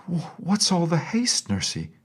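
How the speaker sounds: high, startled question; a little girl caught off guard; puzzled child. A narrator's reading. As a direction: princess asking her running nurse, puzzled. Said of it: "asked Irene".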